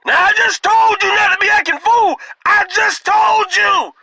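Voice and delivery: put-on high strained shouting old man; granddaddy yelling. Grandfather shouting at his grieving daughter, strained high yell. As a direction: holler it like a fed-up grandfather. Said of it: "my granddaddy is holding her up saying".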